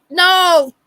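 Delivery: high, loud mock exasperation; exasperated mom calling out in fake outrage; mock outraged yell. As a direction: yell it from the kitchen in mock horror. Mother yelling from kitchen at son's friend.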